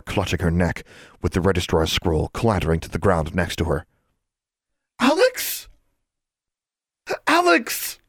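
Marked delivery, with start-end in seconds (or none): 5.0-8.0 high, frightened calling; desperate and frightened. A narrator's reading. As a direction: call her name in rising panic. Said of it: "Anna sounded desperate and frightened".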